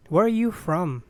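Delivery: ordinary talk, nothing marked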